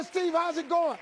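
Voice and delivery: high, loud, peppy caller; excitable, high-pitched phone greeting; John Walker. Retold or imitated. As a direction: open the call with big booming cheer. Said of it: "it's John Walker".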